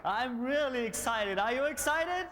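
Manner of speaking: normal talking voice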